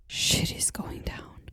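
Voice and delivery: slow, hushed whisper for drama; dramatic whisper. Real, not acted. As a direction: whisper it like a secret. Dramatic scene teaser.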